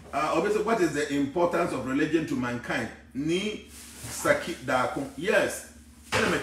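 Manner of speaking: usual speaking voice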